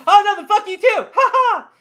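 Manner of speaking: high, loud, taunting retort with a fake laugh; mocking retort. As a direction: throw it back with a childish mock laugh. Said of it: "I goes".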